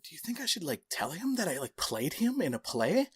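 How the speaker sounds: mildly nervous, hesitant question; nervous asking. Retold or imitated. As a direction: ask softly, unsure if you should. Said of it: "I'm, like, super nervous".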